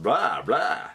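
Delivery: bad vampire Blah blah. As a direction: ham up a cheesy vampire. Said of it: "That's me doing a vampire".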